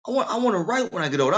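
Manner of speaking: put-on a young son eagerly telling his dad he wants to write; eager young self. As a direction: retell the boy begging his dad, high and hurried. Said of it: "I was like".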